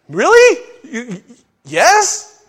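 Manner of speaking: high, loud incredulous question; amazed, pitch leaping upward; acted astonishment. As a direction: squeak it out in disbelief. Acts astonished at being chosen.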